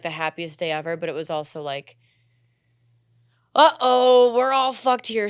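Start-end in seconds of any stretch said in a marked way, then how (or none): 3.5-5.3 sudden loud uh-oh, higher; mock alarm. Girl realizing she's in trouble, mock alarmed. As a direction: blurt out alarm, mock panicked. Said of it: "I was like".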